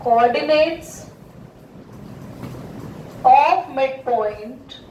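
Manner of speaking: drawn-out, word by word; slow demonstrative reading. Shown as a demonstration. Teacher shows the class how to read it, slowly. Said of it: "इसको पढ़ो".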